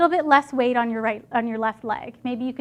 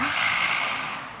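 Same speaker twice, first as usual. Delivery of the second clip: lion's breath exhale; 6 dB quieter than usual. Shown as a demonstration. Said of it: "I wanna see some [name] tongue".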